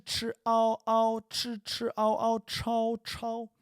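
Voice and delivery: chi, ao and chao high and deliberate; chi ao chao drill. Shown as a demonstration. Teacher drilling chi and chao with students, high and deliberate. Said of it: "So we can say chi".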